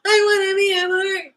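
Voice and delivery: high, loud whiny sob; mock crying wail. Mocking people who wanna be a part, mock crying wail. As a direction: wail it like a hammed-up crybaby.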